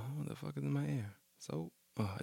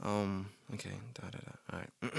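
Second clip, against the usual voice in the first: ordinary talk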